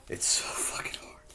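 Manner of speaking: breathy whispered despair. Real, not acted. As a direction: drop to a bare whisper, worn out. Despair over his struggle.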